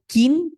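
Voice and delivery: কিন with a bright i, rising; কিন স্পষ্ট করে; clear kin sound. Shown as a demonstration. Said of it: "একটা শুধু কান".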